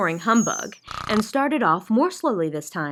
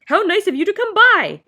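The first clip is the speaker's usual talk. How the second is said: delighted cry, much higher and louder; exclaiming; 12 semitones higher, 9 dB louder than usual. A narrator's reading. The fat man greeting visitors, delighted exclaim. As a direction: burst out in delight at the door. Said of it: "exclaimed the man".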